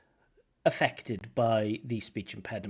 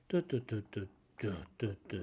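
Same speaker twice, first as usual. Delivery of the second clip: low, soft, falling da da da; sad intonation; 3 semitones lower, 7 dB quieter, much faster than usual. Shown as a demonstration. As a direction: droop the melody like sadness. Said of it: "something sad".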